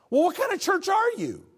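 high, loud indignant squawk; outraged caller. Retold or imitated. Caller mocking the church that won't pay rent, shrill and loud. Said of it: "they'll be like".

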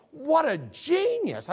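high, drawn-out marvelling; amazed admiration. Retold or imitated. Son looking back on his late dad, amazed admiration. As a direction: marvel at it, stretching the words high.

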